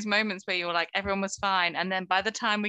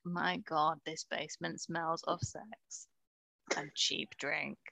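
hushed disgust; 3 semitones lower, 10 dB quieter, slower than usual. Retold or imitated. Imagined party-goer reaction.

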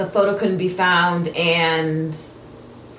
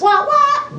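high trombone wah wah, louder; muffled wah wah talk; 4 dB louder than usual. Pretend. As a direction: imitate a muted trumpet going wah wah.

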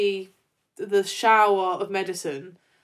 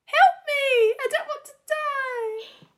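much higher, mock-crying wail; hammed-up dramatic plea; slower than usual. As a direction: play it like an overacting diva. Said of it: "She's so dramatic".

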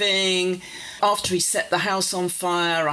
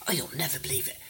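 secret whisper; 4 semitones higher than usual. Retold or imitated. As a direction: whisper like sharing a secret win. Father confiding a secret win.

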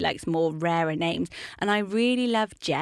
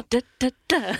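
"Duh, duh, duh" as three separate higher beats; staccato syllables; 6 semitones higher, 4 dB louder than usual. Shown as a demonstration. Host showing three one-syllable names together, staccato "Duh, duh, duh". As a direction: tap out each "Duh" like a one-syllable name. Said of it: "become a little bit like".

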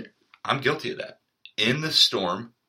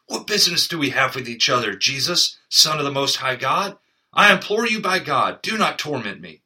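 louder, higher pleading cry of a possessed man; loud shout; 6 semitones higher, 7 dB louder than usual. A narrator's reading. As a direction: cry out begging not to be tormented. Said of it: "shouting with a loud voice".

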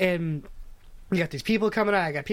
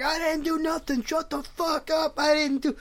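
put-on yelling old man; 9 semitones higher than usual.

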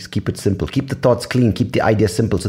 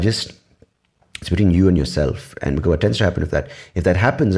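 usual speaking voice

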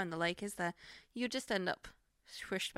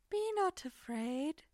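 slow, higher, otherworldly proclamation; glowing alien Mister Burns; 8 semitones higher, much slower than usual. Retold or imitated. Quoting Mister Burns as the glowing alien, slow and eerie. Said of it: "he's like".

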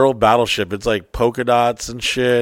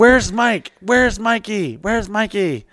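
imitating AK calling; 10 semitones higher, slower than usual. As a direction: call out for Mikey through the door. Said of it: "And then I can hear".

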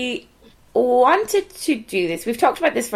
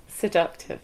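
laughing seductive whisper; 5 semitones lower, 7 dB quieter than usual. Pretend. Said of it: "I'm trying out a new look".

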